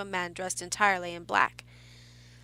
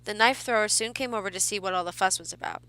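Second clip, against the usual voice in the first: ordinary delivery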